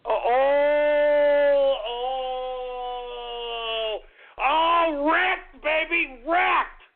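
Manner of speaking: triumphant hype; excited whooping. Real, not acted. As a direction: crow over the kill. Celebrating a kill.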